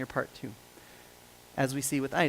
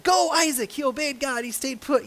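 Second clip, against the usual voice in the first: high-pitched rooting-on; cheering; 9 semitones higher than usual. Acted. Preacher cheers Isaac for obeying God, mock cheering. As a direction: cheer him on like a fan.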